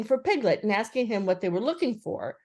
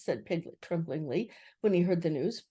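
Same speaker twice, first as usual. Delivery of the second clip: ordinary delivery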